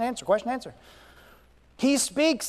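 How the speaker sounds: usual speaking voice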